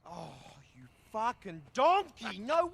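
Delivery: loud, disbelieving card-table outburst; outraged yell. Acted. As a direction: yell at the winner. Losing at cards.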